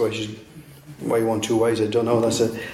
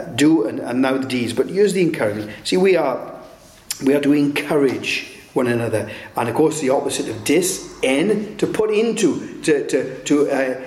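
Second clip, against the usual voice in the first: usual speaking voice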